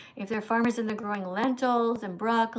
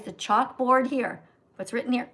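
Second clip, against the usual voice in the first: usual speaking voice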